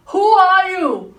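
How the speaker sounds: high, loud and slow, half laughing; startled demand. Retold or imitated. Friend on the trail suddenly confronts her, loud and incredulous. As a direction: fire the question at her, stunned. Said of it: "she turns around at me and says".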